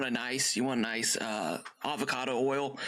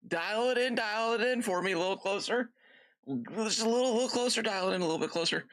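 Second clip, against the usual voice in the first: higher, playful pleading to narrow it down; coaxing dad; 7 semitones higher than usual. Retold or imitated. Dad urging his daughter to pick a dinner, playfully coaxing. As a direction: coax her like tuning a radio, higher.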